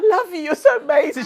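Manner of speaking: high, loud, laughing gush; gushing mum. Retold or imitated. Retelling her mum's loud gushing voice note. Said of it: "And I was like, "What's happened to this woman?".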